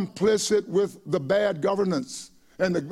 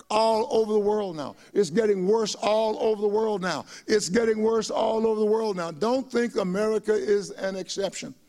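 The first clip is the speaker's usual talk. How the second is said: ordinary delivery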